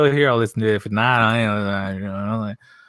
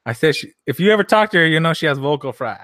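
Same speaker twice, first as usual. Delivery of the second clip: ordinary talk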